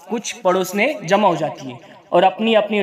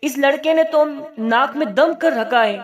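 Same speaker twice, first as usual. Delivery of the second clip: higher, exasperated mother scolding through clenched teeth; through gritted teeth; 7 semitones higher than usual. Acted. Mother scolding her son with his head stuck in a pot, through gritted teeth. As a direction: grind it out, fed up with the boy. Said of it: "नसीबुन दांत पिसते हुए".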